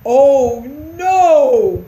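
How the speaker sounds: sinking dismay; dismayed groan. A narrator's reading. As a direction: groan it out in dread. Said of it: "mumbled Christopher".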